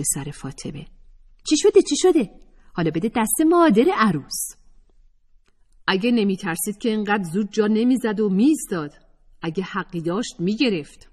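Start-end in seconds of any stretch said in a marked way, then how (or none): 1.5-4.6 put-on high, loud startled young woman; Atefeh's voice. Atefeh turning round, high and loud. 5.9-11.1 put-on higher, scornful young woman; a hint of scorn in a higher voice; Atefeh's voice. Atefeh mocking the absent girl, higher.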